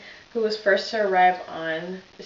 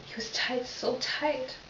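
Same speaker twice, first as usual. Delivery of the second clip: choked up; 10 dB quieter than usual. Acted. Officer breaks down recalling victim.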